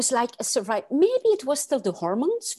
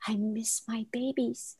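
whispered longing; 9 dB quieter than usual. Retold or imitated. Retold first thought in hospital.

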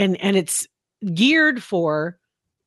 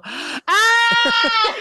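piercing mock dog scream; imitated dog scream. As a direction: shriek like the panicked poodle. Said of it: "She'd go".

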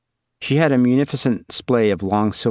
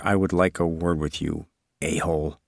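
muttered grudge; 4 semitones lower, 4 dB quieter than usual. Acted. Aside insulting the author.

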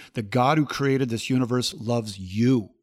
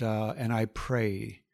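normal talking voice

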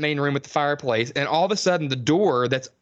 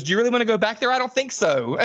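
high, fast prissy mimic; mock Jessica; 7 semitones higher, faster than usual. Mocking Jessica's repeated line, high and quick. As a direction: rattle off the line like a haughty heroine. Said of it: "she goes".

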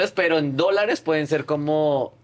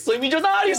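much higher, laughing mock joy; mock millionaire glee; 13 semitones higher than usual. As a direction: gloat jokingly over the money. Joking about first hundred dollars. (alegría burlona de millonario, riendo)

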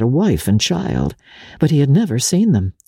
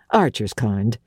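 ordinary delivery, nothing marked

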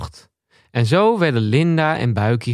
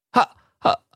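stammering; 6 semitones higher than usual. A narrator's reading. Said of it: "stamelde ze".

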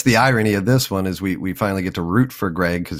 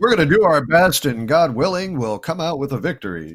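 GM voice; 9 semitones higher than usual. Retold or imitated. Mocking a bland GM statement.

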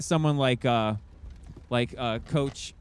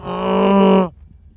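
loud sharp gasp; surprised gasp; 4 semitones higher, 9 dB louder than usual. Real, not acted. Reacts to the story.

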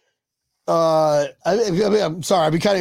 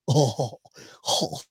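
normal talking voice

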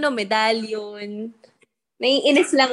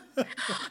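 breathless laugh; 9 dB quieter than usual. Real, not acted. Laugh after retold taunt.

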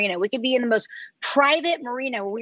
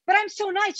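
much higher, plaintive "I'm so nice"; mock whiny protest; 11 semitones higher than usual. [name] imitating herself protesting to her crew, mock whiny. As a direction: whine it like an innocent child. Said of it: "I'm like".

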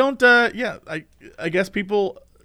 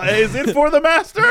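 high, laughing, everything ends rising like a question; mock her question-like reading; every line a question; 8 semitones higher, 4 dB louder than usual. Friend mocks a Lich boss reading lines as questions. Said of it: "reading every line ... like it's a question".

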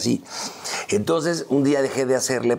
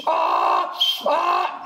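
high braying ah pitched far up; hee-haw like a donkey, very high; imitating a donkey's bray for the table. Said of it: "él rebuznaba y empecé".

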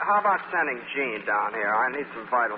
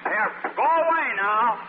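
high, frantic shooing with a laugh; high flustered brush-off; shooing away; 9 semitones higher than usual. Acted. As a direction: shoo him off in a fluster. Shooing off the visitor.

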